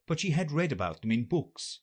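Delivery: ordinary delivery, nothing marked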